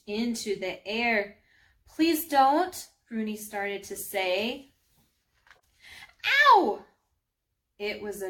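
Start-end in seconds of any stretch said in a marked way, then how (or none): 1.9-2.9 high, loud, urgent plea; alarmed plea. Acted. Pleading before being hit. 6.2-6.8 loud, high yelp of pain; cry of pain. Acted. Hit by a thrown rock.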